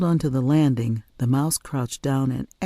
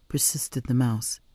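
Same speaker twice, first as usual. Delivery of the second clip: usual speaking voice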